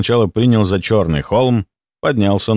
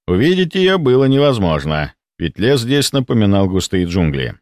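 plain reading voice

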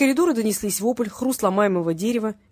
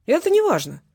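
breezy, high-pitched and louder; lively, raised cheerful reply; 8 semitones higher, 6 dB louder than usual. A narrator's reading. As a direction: brush it off merrily. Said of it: "весело отозвался Валерий".

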